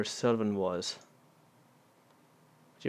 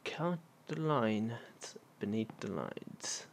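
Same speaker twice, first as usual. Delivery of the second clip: slow reading; 7 dB quieter, much slower than usual. Real, not acted. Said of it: "reading clue slowly".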